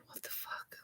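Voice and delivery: hushed, unvoiced disbelief; whispered shock. Hospital staff seeing her return, whispered shock. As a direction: breathe it out in astonished hush.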